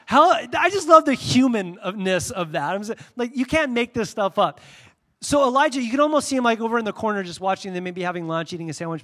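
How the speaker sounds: ordinary talk, nothing marked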